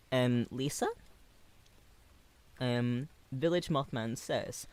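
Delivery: normal talking voice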